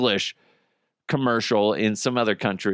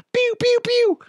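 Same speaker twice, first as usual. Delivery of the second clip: high squeaky laser-gun sounds; pew-pew noises; 4 dB louder than usual. Pretend. Host goofing after a bit, high pew-pew noises. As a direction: fire off tiny toy-gun noises.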